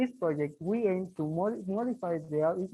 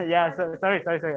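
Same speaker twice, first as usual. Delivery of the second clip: louder for the room; pushing the voice out louder; 7 dB louder, faster than usual. Real, not acted. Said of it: "A louder?".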